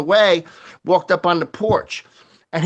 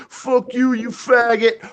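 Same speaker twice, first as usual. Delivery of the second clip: put-on drunk guy voice; 6 semitones higher than usual. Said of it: "He goes".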